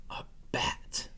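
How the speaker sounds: usual speaking voice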